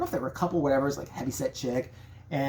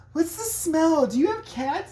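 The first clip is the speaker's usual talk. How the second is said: high, disgusted woman's voice; high squeamish lady asking about cats; shocked woman; 13 semitones higher, 3 dB louder, slower than usual. Retold or imitated. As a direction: recoil at the smell, high. Voicing the woman.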